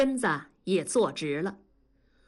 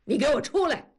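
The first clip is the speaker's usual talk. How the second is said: raised, commanding yell; shouting into room; 7 semitones higher, 3 dB louder, faster than usual. A narrator's reading. Father calling son out, sharp higher shout. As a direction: order him out sharply. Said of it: "冲着大宝房间里喊".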